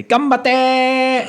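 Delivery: high, loud rallying shout; a bright anime-style shout of encouragement; cheerful battle cry. Acted. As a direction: cheer them on as you leap. Player's character calls out before jumping.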